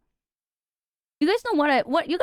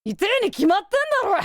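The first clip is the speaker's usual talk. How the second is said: exasperated retort; 6 semitones higher, faster than usual. Retold or imitated. Exasperated outburst at therapist.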